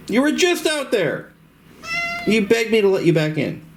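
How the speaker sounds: high pet voice, mock scolding; high pet-talk scolding. Real, not acted. Owner scolding his cat in a high pet voice.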